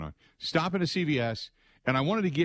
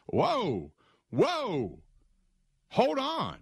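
drawn whoas then a high hold on; 6 semitones higher, much slower than usual. Retold or imitated. Host stopping the DJ's gloomy prediction: high hold on. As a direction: rein in the rant.

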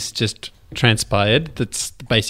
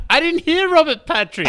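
high mock confused voice. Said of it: "I'm like".

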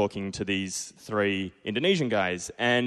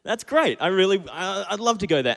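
high, eager yes to the trip; mock thrilled student agreeing to Indonesia; excited; 11 semitones higher, 4 dB louder than usual. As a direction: gush like a kid offered a holiday. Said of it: "And I was like".

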